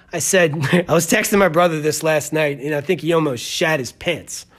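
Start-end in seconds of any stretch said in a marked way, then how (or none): none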